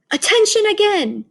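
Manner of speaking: high, loud command; commanding shout. A narrator's reading. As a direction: call them to order. Witch commanding.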